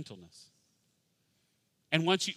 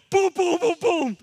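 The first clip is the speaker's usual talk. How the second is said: high, loud punchy booms; mock schoolboy roughhousing, loud; rowdy boys imitation; 10 dB louder than usual. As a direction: fire off playful impact noises like excited boys. Imitates boys bumping each other.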